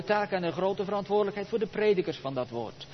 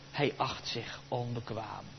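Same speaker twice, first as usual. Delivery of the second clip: usual speaking voice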